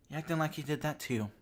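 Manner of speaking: ordinary talk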